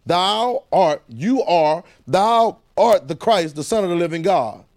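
"Thou art" slow and loud, each word weighted; emphatic scripture reading. Shown as a demonstration. Preacher pointing class to the terminology, emphatic. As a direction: hit "thou art" hard and drawn out. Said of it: "Listen to the terminology".

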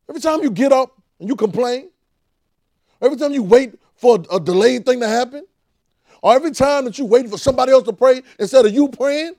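high, loud, indignant demand; Jesus losing patience with his people; exasperated Jesus shouting. Retold or imitated. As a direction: shout it like a wronged father. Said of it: "I see Jesus talking to me that way".

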